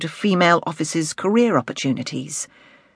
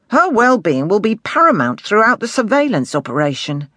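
firm, offended superior, pitch often raised; inspector rebuking; 5 semitones higher, 4 dB louder than usual. Acted. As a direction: put the sergeant in his place.

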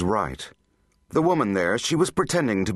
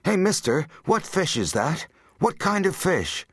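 put-on high-pitched little boy's questioning; much higher child voice, pestering an adult; 9 semitones higher than usual. A narrator's reading. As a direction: pipe up like a curious small boy. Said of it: "It was the little boy".

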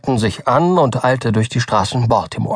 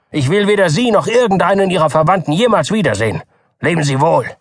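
raised, fast indignant tirade; cutting, angry doctor; 4 semitones higher than usual. A narrator's reading. Doktor Keene angrily breaks with the Button family. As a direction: dismiss him with scorn. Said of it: "antwortete der Doktor schneidend".

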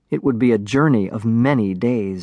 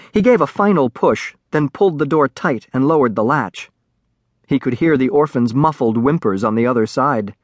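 ordinary delivery

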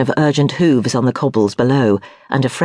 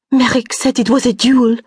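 panicked report; 10 semitones higher than usual. A narrator's reading.

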